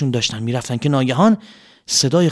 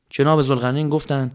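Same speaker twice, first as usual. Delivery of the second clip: ordinary delivery, nothing marked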